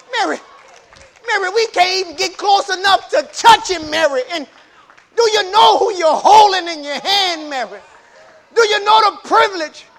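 high, shouted amazement; loud, urgent wonder; awed angels to Mary. Acted. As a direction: preach it as astonished angels crying out to Mary. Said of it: "The angels looking at Mary and say".

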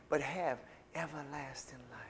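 breathy whispered phrase. Real, not acted. Preacher whispering scripture to the congregation. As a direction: let the promise fall to a whisper.

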